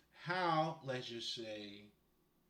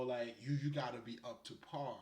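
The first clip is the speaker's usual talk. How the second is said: ordinary delivery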